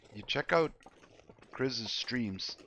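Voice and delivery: normal talking voice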